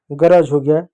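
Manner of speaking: ordinary talk, nothing marked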